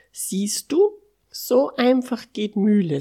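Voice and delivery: high, loud, pleased explanation; triumphant demonstration. A narrator's reading. Character proudly shows how easy it is. (triumphierend, hoch und laut)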